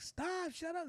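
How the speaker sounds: put-on high, squealy girl's denial; playful high-pitched crush reply; mock flustered girl. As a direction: squeal it like a giddy fifth-grade girl.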